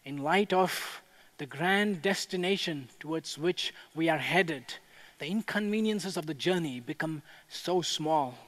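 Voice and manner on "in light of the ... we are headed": ordinary talk, nothing marked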